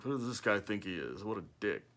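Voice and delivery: not-rich people judging the Rolls owner, muttering; resentful mutter. Retold or imitated. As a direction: grumble it under your breath with disdain. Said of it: "they're just like".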